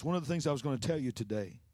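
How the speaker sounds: normal talking voice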